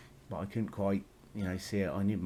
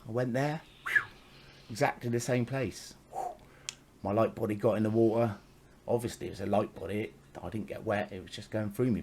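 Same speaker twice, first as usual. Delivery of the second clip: ordinary delivery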